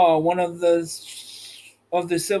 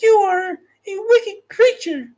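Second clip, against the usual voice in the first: high and louder, accusing; indignant cry; 4 dB louder than usual. Acted. Dorothy cries out at the witch in anger. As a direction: fling the accusation at her. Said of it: "cried Dorothy".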